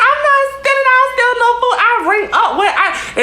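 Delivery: very high, whiny imitation of the accused; exaggerated squealed self-defence; mock protesting denial. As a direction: protest innocence in a silly high voice. Retold denial by accused friend.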